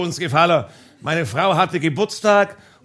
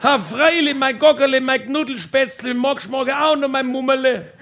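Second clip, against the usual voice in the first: higher, laughing mock-sweet reply; wife calling back; 5 semitones higher than usual. Wife calls back to husband with pet names, high and laughing. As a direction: answer with a doting laugh. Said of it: "wife answers across the yard".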